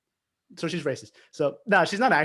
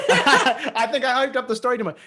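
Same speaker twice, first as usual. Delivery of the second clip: high, loud, cracking up; laughing; 8 semitones higher, 6 dB louder than usual. Real, not acted. Storyteller admitting to overhyping the story, laughing. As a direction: burst out laughing through the words.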